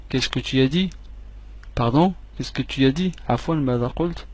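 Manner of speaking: ordinary talk, nothing marked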